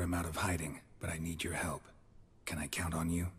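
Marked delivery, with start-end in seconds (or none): 2.4-3.3 whispered request. Real, not acted. Geralt asking Madame Irina for help, in a whisper. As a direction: ask it under your breath.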